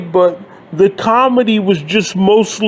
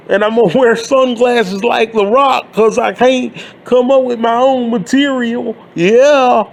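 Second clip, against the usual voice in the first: higher, brash mocking wrestler swagger; L A Knight impression; 4 semitones higher than usual. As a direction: ham up a cocky promo, pitched up. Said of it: "L A Knight".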